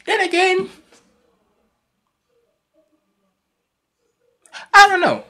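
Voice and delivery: high-pitched, unsure second thought; doubtful hedging. Real, not acted. Reactor second-guessing his take on Mina, high-pitched doubt. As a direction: hedge it in a high voice.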